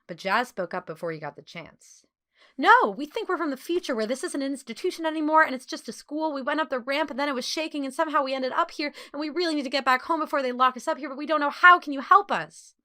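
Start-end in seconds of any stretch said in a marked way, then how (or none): 2.6-12.7 fast, higher, breathless rush of words; rapid chatter. A narrator's reading. Jasmine pleading with the sewing girl for help, rapid chatter. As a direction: blurt it all out without stopping. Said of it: "She spoke so quickly that her words were impossible to understand".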